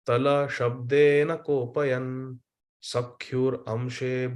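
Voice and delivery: slow, measured Sanskrit chant; slow scripture recitation; verse recitation. Shown as a demonstration. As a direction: recite the verse slowly and reverently.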